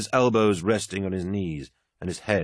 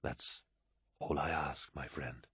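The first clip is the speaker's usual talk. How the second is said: low-key, very soft gratitude; smiling warmth; 3 semitones lower, 14 dB quieter, slower than usual. A narrator's reading. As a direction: thank a friend quietly, with a smile. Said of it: "smiled Horus".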